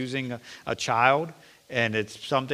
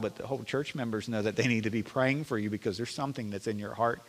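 ordinary talk, nothing marked

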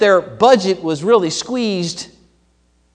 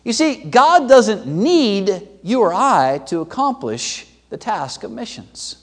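ordinary talk

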